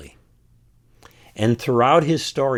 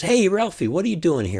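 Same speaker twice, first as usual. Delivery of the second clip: put-on a cheerful old man's voice, pitched much higher; kind imagined father; 9 semitones higher than usual. Father happily greets Ralphie out at the car. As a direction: greet Ralphie like a delighted dad. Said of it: "and the old man says".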